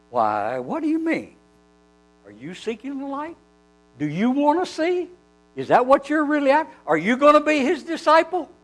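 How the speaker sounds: high, rising mock-innocent questions; sarcastic. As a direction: needle them with feigned curiosity, each question lilting upward. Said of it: "answers with a little bit of amazing sarcasm".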